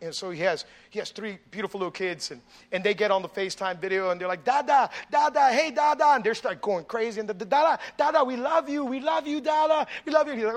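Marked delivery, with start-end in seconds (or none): 4.4-6.1 put-on kids calling dad. Preacher voicing small kids on FaceTime. 7.5-10.3 put-on kids calling dad. Preacher voicing small kids on FaceTime.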